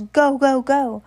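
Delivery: put-on high, loud shout at the girls. Max yelling at two girls to leave. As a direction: drive them out with a yell. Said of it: "he shouted at the two girls".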